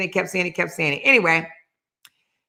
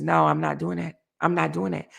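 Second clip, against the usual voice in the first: quiet refusal; 8 dB quieter than usual. Retold or imitated. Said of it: "she says".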